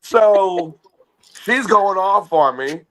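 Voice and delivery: normal talking voice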